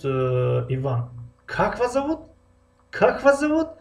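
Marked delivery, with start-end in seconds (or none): 1.5-3.7 Как вас зовут with вас sung out much higher; emphatic re-ask. Acted. Teacher acting a listener who re-asks a name, emphatic. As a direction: press on вас, asking again in disbelief. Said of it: "барои таъкидӣ мо як бор дига савол мекунем".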